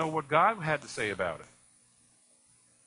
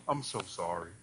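somber doctor; 8 semitones lower, 6 dB quieter than usual. Retold or imitated. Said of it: "That's what he kept saying".

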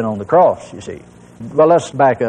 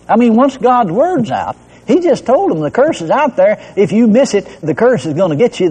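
higher, driving preacher's cadence; fervent preaching; 5 semitones higher than usual. Acted. Preacher drives the point home to the congregation, fervent.

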